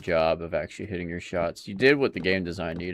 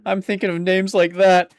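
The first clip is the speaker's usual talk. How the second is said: high, loud, helpless amusement; cracked up, pitch riding high; real laughing fit; 11 semitones higher, 8 dB louder than usual. Real, not acted. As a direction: talk through the tail of a big laugh. Cracking up at own silly name.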